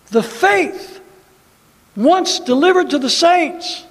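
loud defiant rallying preaching; rally the congregation, fired up; roaring rallying speech. Acted. Continues rallying declarations.

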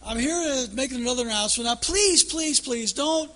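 high, loud, fast pleading pilot; hammed-up frantic captain; panicky captain. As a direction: beg the passengers not to panic. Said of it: "He says".

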